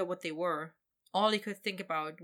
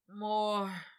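slurred; 3 semitones higher than usual. Acted. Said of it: "he slurred".

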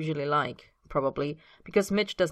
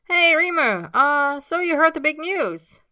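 sheepish cheer; 11 semitones higher, 8 dB louder, slower than usual. Acted. Mitch breaking news playfully.

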